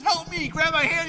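high, loud cry for help; mock desperate plea. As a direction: imitate someone dangling from a cliff. Man hanging off cliff, acted.

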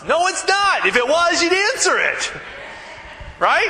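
indignant high-pitched comeback; exasperated retort. Real, not acted. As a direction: scoff at the phone message. Venting about hold messages.

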